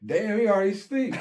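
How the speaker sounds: high, loud retold disbelief; amazed exclamation. Cellmates marvel at sleeping uncle, amazed exclamation.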